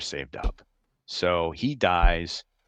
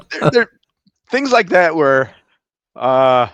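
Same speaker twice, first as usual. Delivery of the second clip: laughing; 7 semitones higher, 9 dB louder than usual. Real, not acted. Laughing over prank confession.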